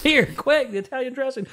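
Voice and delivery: high frantic voice; hurry, grab the dressing; panicked rescuer. Acted.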